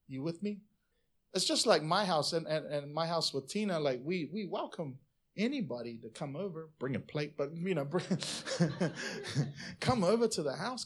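normal talking voice

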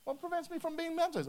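high-pitched put-on voice; mock eager eunuch. Eunuch to a reluctant Philip, mock high eagerness. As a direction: ask it high, mimicking a keen convert.

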